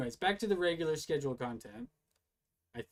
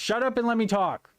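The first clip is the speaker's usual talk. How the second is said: higher, louder shouted command; angry shout; 8 semitones higher, 11 dB louder than usual. Real, not acted. Shouting at Brian to let him talk, angry. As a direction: yell to be heard over him.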